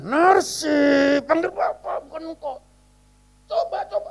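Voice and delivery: high, pretend-stern scolding; mock anger. Preacher pretending to scold the child, playful mock anger. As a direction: fake a cross telling-off, half laughing. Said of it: "Saya pura-pura marah".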